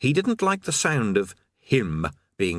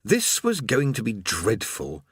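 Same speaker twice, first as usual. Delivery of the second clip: ordinary delivery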